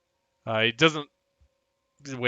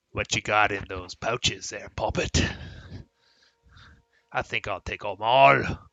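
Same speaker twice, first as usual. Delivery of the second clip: orc thug; 6 dB louder than usual. Acted. Orc demanding a traveller's pouches, bullying. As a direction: play a bullying orc shaking someone down. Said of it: "orc that's right here".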